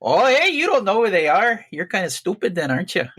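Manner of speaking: put-on high, loud Fargo accent easing down; hammed-up Fargo-style northern accent. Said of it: "It's more Fargo".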